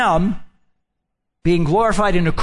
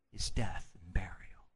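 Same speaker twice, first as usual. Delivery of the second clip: whisper; 10 semitones lower, 16 dB quieter than usual. Acted. Hushed refrain ending.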